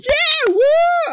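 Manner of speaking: high, loud whooping cheer; mimicking the theater crowd cheering.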